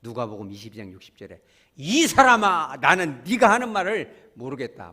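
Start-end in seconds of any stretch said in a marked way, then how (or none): 1.7-4.9 loud slow angry snap; angry outburst. Retold or imitated. Peter angrily denying Jesus, loud outburst. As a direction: bark the denial in panic. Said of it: "막 화를 버럭 냅니다".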